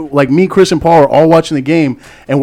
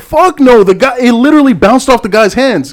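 outraged denial; 9 semitones higher than usual. Real, not acted. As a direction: fire back in real disbelief. Heated dispute over the play.

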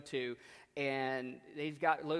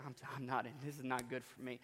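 softer, lower, under the breath; muttered dismay; 3 semitones lower, 9 dB quieter, faster than usual. Retold or imitated. Stranded coach muttering to himself, soft and low. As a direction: mumble it to yourself, defeated.

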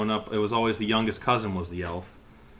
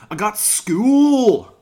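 put-on high, loud, slow Scottish child's whine; imitating a Scottish kid; 10 semitones higher, 7 dB louder, much slower than usual. Host mimicking the Scottish kid in the viral video, a high, slow Scottish whine. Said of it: "They sounded Scottish".

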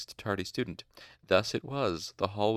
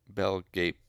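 ordinary delivery, nothing marked

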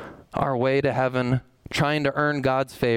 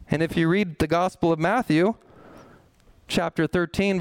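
usual speaking voice

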